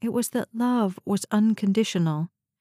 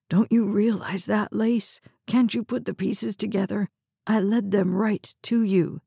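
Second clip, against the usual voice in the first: usual speaking voice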